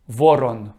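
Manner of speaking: Voron said the Russian way, rolled r, stress on first syllable; Russian reading. Shown as a demonstration. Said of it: "put your Russian glasses on".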